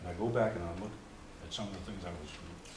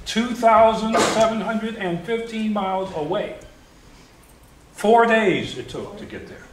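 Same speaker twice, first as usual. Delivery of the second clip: much higher, louder, slowed for the huge distance; emphatic distance; 12 semitones higher, 10 dB louder, slower than usual. Real, not acted. Telling the class about the long bus ride, emphatic slow wonder. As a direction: stretch out every number with wonder.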